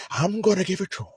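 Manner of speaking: a quick, soft take on a movie villain; Heath Ledger Joker. Retold or imitated. Imitating the Joker for viewers, lightly voiced. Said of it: "He kinda talked like this, like Heath Ledger".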